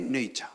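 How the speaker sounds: "nature" said the English way; English pronunciation. Shown as a demonstration. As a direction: say "nature" as an English speaker would. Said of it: "y te dicen".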